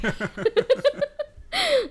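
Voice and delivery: high, loud burst of laughter; loud, high giggling fit; helpless laughter. Real, not acted. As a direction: crack up at your own story. Laughing at own story.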